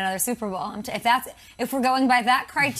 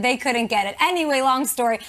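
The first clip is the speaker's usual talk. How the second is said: ordinary delivery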